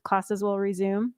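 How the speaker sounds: ordinary talk, nothing marked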